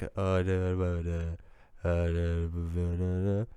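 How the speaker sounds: low, soft, sultry murmur; deep sultry voice. Retold or imitated. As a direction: drop into a deep sultry hum. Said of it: "In your, your deep, sultry voice".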